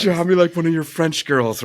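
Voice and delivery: high, breathy-free swoon of a movie line, mock dramatic; Titanic quote. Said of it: "like Titanic".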